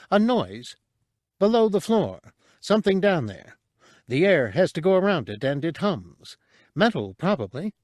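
high chirpy voice, tense and focused; squeaky and quick, reporting what she hears; a high little alien voice, wary; small creature listening. A narrator's reading. Cabe's voice.

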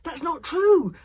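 put-on loud, high indignant boy; high outraged denial; protesting. A narrator's reading. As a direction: deny it hotly at full volume. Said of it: "protested Hiccup".

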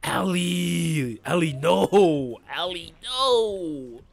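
slow, stretched-out cry of dismay; drawn-out plea. Real, not acted. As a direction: drag out the no in despair. Calling out to a teammate in game.

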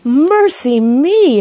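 loud, high, slow Mercy me!; exclaiming. A narrator's reading. Housekeeper hearing the bear will stay, exclaiming. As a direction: throw up your hands in dismay. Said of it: "exclaimed Missus Bird".

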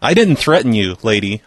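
ordinary talk

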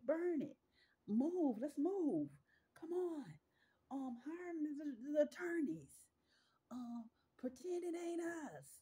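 hammed-up panic of the guilty; panicked mock guilty crowd. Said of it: "They all running around".

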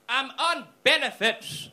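very loud, high, drawn-out declaration; old man's mock bellow. Old guy claiming the seat, hammed-up slow bellow. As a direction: boom it out as the trump card. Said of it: "He just said".

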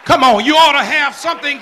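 loud, ringing church preaching; booming Sunday sermon voice; full-force preaching. Acted. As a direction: preach it out to the whole congregation.

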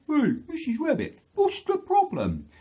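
high, loud story-bear hail; Mister Bear. Acted. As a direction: call out as a concerned bear. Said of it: "but Mister Bear".

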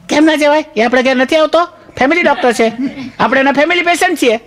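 high-pitched imitation of a wife; wife's voice. Wife urging him to the doctor.

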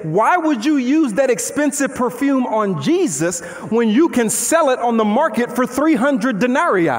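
usual speaking voice

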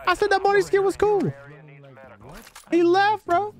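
high-pitched playful complaint; high mock-offended protest; amused protest. Real, not acted. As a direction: whine it out, laughing at them. Teasing player who left.

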